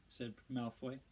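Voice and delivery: plain reading voice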